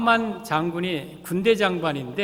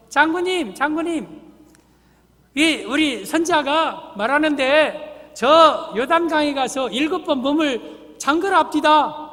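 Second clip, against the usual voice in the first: raised, higher servant voice relaying orders; a lightly voiced servant; servant's message; 7 semitones higher, 5 dB louder than usual. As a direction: play the prophet's servant calling out to the general.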